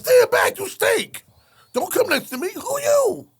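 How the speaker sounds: very high, loud disgusted warden; high loud warden yelling in disgust. Retold or imitated. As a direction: push him away with a shout. Warden recoiling from the smell.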